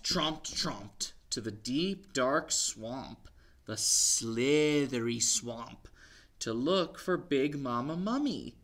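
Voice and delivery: lively picture-book read-aloud; story reading to children. A narrator's reading. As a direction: read it to little ones.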